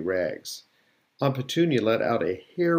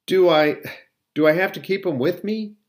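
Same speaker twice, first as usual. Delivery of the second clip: higher, dismayed, halting question; aghast; 7 semitones higher, 5 dB louder than usual. A narrator's reading. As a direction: stammer it in mild horror.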